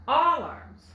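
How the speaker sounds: "alarms" with an open ah as in wallet, stress on the first syllable; wrong ah try. Shown as a demonstration. Teacher testing a second wrong reading, open ah in "alarms". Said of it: "still doesn't sound quite right".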